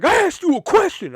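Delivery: high, loud angry yell; customer screaming. Retold or imitated. Customer yelling at the cashier over coupons, high furious shout. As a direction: bark it at the cashier in a rage. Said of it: "he fucking started screaming at me".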